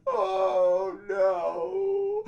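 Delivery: high crying wail; weeping. Acted. Wife weeps bitterly over the holiday, crying. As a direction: sob out the words. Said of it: "began to weep bitterly".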